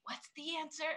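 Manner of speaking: high, hushed mock whisper; imitated whisper. Pupil asking a classmate during a test, mock whispering. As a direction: sneak it softly to a neighbor. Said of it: "whispered to somebody near me".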